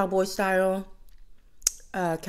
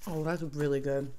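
words between chews; talking while chewing; 3 semitones lower than usual. Real, not acted. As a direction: mouth partly full of food. Seen 0: Chews after bites before; cheeks full, lips working at 0.1–0.8.

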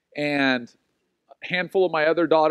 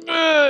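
put-on childish groan; 12 semitones higher, 5 dB louder than usual. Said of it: "such a childish response".

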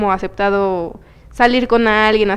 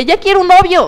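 eager wish; 8 semitones higher, 5 dB louder than usual. Retold or imitated. Longing retold to herself.

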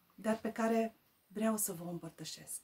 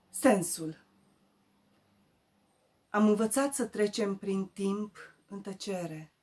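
louder, declaimed poem with pauses between lines; recitare de poezie; 7 dB louder than usual. Acted. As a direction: declaim the verse, strong openings settling at line ends. (poezie declamată, rostită apăsat)

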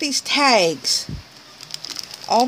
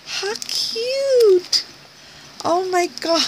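high squeal; 9 semitones higher than usual. Real, not acted. Squealing at a cute gift.

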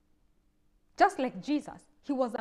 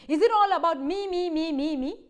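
higher, louder mock whine of "me, me, me"; mocking self-centred; 4 semitones higher, 6 dB louder than usual. Preacher parodying a me-centred prayer. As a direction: parody a selfish pray-er.

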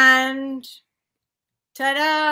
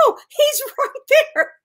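much higher, laughing through it; giggling insistence; 13 semitones higher, 6 dB louder, slower than usual. Retold or imitated. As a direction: insist while breaking into laughter. Insisting the owl is there.

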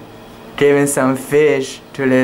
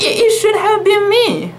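high, stammering jealous kitten cry; blurted out. A narrator's reading. Jealous Satwa blurting to Fufu in the garden. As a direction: burst out with hurt envy. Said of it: "blurted Satwa".

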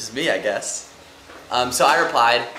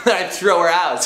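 laughing; 8 semitones higher, 9 dB louder, slower than usual. Real, not acted. Laughing about kicking her out.

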